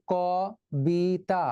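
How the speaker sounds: "ক বি তা" split, with open kaw; as spelt. Shown as a demonstration. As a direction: sound out "ক বি তা" syllable by syllable, first kaw. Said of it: "উচ্চারিত হওয়ার কথা ছিল". ("ক বি তা" ভেঙে, খোলা অ দিয়ে)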